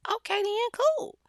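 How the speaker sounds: high-pitched, coy acceptance; eager agreement. Acted. Smitten woman agrees to a ride, high eager voice. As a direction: pitch it way up, rising on "then".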